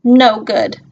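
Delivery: ordinary delivery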